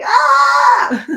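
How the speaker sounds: short loud laughing yelp; mock panic scream. Imitating narrow-minded panic, laughing yelp. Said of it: "we're like".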